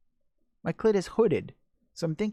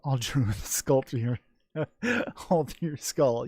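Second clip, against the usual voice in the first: laughing. Real, not acted. Laughing at a joke.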